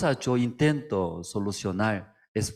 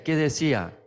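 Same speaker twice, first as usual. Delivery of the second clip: usual speaking voice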